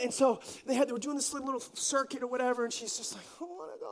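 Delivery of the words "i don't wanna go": small, high, soft grumble; reluctant child. Retold or imitated. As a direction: grumble like an unwilling little kid. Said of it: "she reluctantly went".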